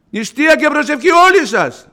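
calling out high and loud to the flock; fervent high call to prayer; rousing call. Acted. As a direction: proclaim it like a summons to fast. Preacher calls on the congregation.

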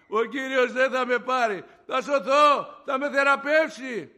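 high, slow, shouted declaration; exultant full-voiced certainty, stretched out; jubilant proclamation. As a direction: proclaim your rescue to everyone. Retold joy at promised healing.

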